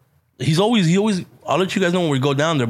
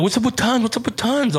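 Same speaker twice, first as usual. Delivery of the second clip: higher, eager asking fans; curious fans; 8 semitones higher than usual. Retold or imitated. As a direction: imitate people pestering you. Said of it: "And people are like".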